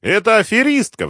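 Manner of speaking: very high, loud squeal; визг. A narrator's reading. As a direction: squeal the accusation in outrage. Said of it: "взвизгнул депутат". (возмущённый громкий визг)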